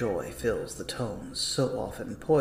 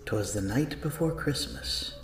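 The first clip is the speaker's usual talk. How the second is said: ordinary talk, nothing marked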